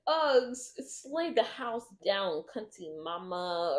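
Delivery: hammed-up Brittany Broski. Podcast guest hyping his Uggs in slang, hammed-up. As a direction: stretch it out like over-the-top slang praise. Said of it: "why you're talking like that".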